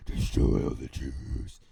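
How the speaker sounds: slow, very low, loud rasped whisper; villainous growl. Acted. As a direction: growl it slowly like a cartoon villain. Repeating Haman's villain growl.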